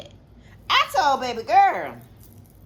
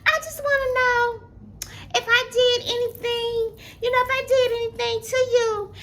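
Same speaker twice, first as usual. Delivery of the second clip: much higher, innocent-sounding voice; imitating the innocent caller; 11 semitones higher than usual. The bully asking on the phone, high and fake-innocent. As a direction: play it falsely innocent.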